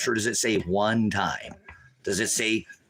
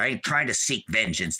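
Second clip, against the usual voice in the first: ordinary talk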